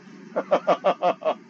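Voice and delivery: chuckling burst of laughter. Real, not acted. Teacher amused at his own example, laughing. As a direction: laugh in quick, even pulses.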